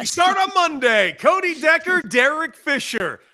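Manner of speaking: high booming show plug; hyped radio promo. Acted. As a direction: pump up the crowd for launch.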